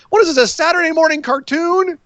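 high-pitched exasperated outburst; incredulous. Real, not acted. Host ranting about the silly fight rules, incredulous. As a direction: demand it in sheer disbelief.

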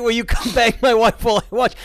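high, loud, laughing through it; laughing mock question. Friend quoting the prank call while laughing, high and loud. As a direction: squeal the question out between laughs. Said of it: "Oh, that's right".

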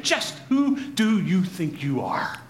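slow, pointed demand; indignant challenge. Acted. Offended townspeople confront Jesus in the synagogue, indignant challenge. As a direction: challenge him one deliberate word at a time. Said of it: "asking that exact same question".

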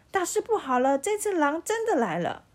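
loud, high alarm call; shouting to the village. A narrator's reading. Shepherd boy calling the village again, loud alarm. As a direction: yell the warning again. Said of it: "他又跑到村庄里大喊说".

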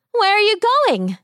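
high calling fairy voice; sprite calling out. A narrator's reading. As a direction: call out like a sprite. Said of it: "called the sprite".

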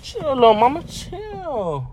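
very high, drawn-out "chill"; playful high plea. Pretend. Reacting to another driver.

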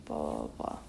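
ordinary talk

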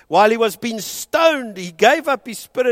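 ordinary delivery

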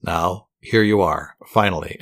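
plain reading voice

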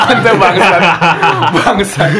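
loud curse through laughter; laughing curse. Real, not acted. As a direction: swear while cracking up. Cursing while laughing at memory.

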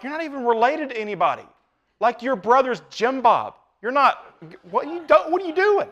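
incredulous. Retold or imitated. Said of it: "people go digging around and be like".

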